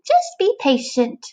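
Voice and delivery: high, slow little caterpillar voice coaching himself; a small bug talking himself into waiting; caterpillar telling himself. Acted. Caterpillar urging himself to wait, high and slow.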